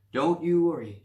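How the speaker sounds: loud, sure reassurance; confident. Acted. Nancy reassuring the doctor, confident. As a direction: brush off his worry. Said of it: "she said confidently".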